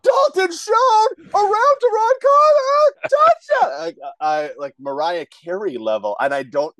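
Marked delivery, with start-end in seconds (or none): 0.0-3.8 screamed high touchdown call, stretched slow; sky-high voice cracking with excitement; super high-pitched call. Shown as a demonstration. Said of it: "I get super high pitched".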